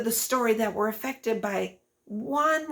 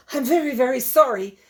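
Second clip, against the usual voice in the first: panting apology; 4 semitones higher, 6 dB louder than usual. A narrator's reading. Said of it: "panted Snail, quite out of breath".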